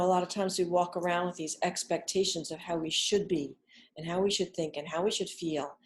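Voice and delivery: usual speaking voice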